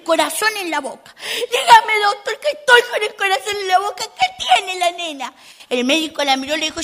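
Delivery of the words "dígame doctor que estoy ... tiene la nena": high, pleading mother's voice; anxious mother. Retold or imitated. Worried mother asks the doctor about her daughter, high plea. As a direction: beg the doctor like a worried mom.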